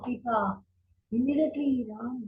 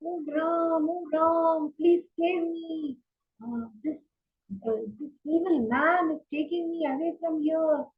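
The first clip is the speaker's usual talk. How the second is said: a frightened plea, pitched up and slowed on the hero line; desperate shout for help; 6 semitones higher than usual. A narrator's reading. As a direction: cry out to Ram as she is carried away. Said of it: "Sita was shouting".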